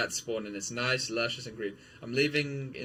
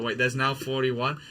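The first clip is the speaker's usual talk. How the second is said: ordinary delivery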